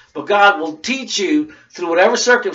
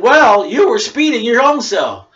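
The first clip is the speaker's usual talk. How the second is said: higher, louder, slow accusing retort; pointed comeback; 6 semitones higher, 5 dB louder than usual. Pretend. To the speeding lady, a higher, louder, slow accusing retort. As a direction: throw it back at her, slowly.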